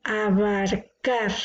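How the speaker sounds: "Abarcar" slowly, a-bar-CAR, weight on CAR; stress on last syllable. Shown as a demonstration. Teacher introducing a word, slow "Abarcar" with long CAR. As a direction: draw out "Abarcar" toward its final syllable. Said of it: "La sílaba tónica es la última".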